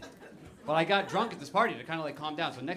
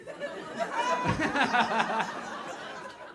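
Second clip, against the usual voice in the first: breathy laugh; 10 semitones higher than usual. Real, not acted. Laughing at rejection email.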